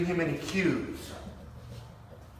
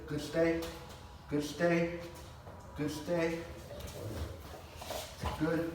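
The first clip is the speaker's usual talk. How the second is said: calm; 9 dB quieter, much slower than usual. Real, not acted. Calming the dog to stay.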